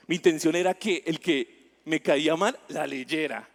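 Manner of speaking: ordinary delivery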